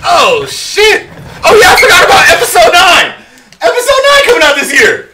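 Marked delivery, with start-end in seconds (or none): 1.4-5.1 high, loud, overjoyed cry; excited shout. Real, not acted. A co-host remembering Episode nine in an excited shout. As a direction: burst out remembering.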